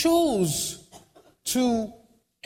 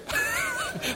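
high laugh; 5 dB quieter than usual. Real, not acted. Laughs at own joke.

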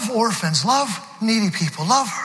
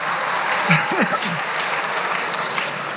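breathy laugh. Real, not acted. Laughs again.

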